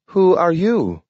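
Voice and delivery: high, talking dog; a dog speaking in a high voice. A narrator's reading. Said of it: "asked Nathaniel".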